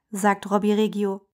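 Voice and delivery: ordinary delivery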